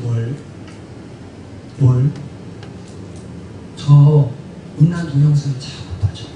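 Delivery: low, hushed confession with halting pauses; confessional prayer. Acted. Believer admits a repeated sin to the Lord, low and subdued. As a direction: confess haltingly, as if alone with God.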